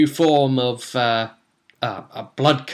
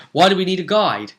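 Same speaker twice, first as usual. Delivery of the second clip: quick, higher-pitched wary question; suspicious; 5 semitones higher, 3 dB louder, faster than usual. Acted. Party questioning their would-be guide, suspicious. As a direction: challenge him with a touch of suspicion. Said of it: "suspicious at this".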